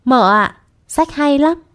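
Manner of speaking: put-on high-pitched happy child-to-mother report; acted cheerful enthusiasm. A narrator's reading. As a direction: call out, then lift the pitch in delight. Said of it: "nên càng vui vẻ".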